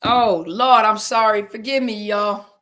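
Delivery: loud, emphatic plea for forgiveness; distressed apology. Real, not acted. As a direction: cry out your apology to the audience. Apologizing for cutting off stream.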